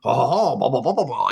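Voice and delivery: hint of a French accent; mock French. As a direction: babble like a caricature Frenchman. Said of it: "with French-speaking Twi'leks".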